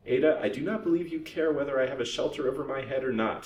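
stung anger. A narrator's reading. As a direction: answer back as if stung. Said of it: "stung into anger by the pointed speech".

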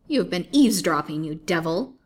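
loud, indignant accusation; wife crying out at an eavesdropper; rage. A narrator's reading. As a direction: burst out loudly, then drop on the insult. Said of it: "cried his wife in a rage".

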